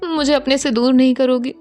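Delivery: tearful pleading. Acted. Aarna begs Anshika through tears not to leave. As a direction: beg her through tears, voice climbing. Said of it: "Aarna की आंखों से continuously आंसू आ रहे थे".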